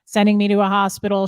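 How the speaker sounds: usual speaking voice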